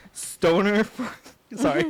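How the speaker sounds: amused, barely holding back laughter; cracking up. Real, not acted. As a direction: start reading and crack up midway. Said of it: "that cracks me up".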